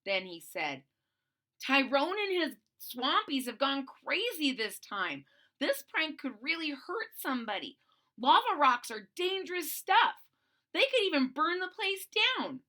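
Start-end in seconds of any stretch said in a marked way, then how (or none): 1.6-12.6 alarmed urging. Acted. Boland alarmed, urging friends.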